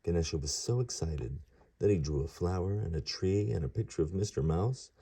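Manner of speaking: ordinary delivery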